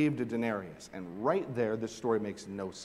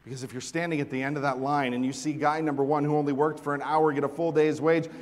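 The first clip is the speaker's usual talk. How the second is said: ordinary talk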